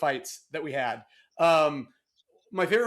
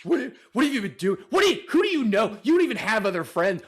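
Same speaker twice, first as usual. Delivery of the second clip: louder, high-pitched mock nagging; jealous interrogation; 5 semitones higher, 6 dB louder than usual. Roommate plays partner questioning his absence, mock jealous interrogation. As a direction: grill him like a jealous spouse, hammed up. Acting out a petty jealous quarrel.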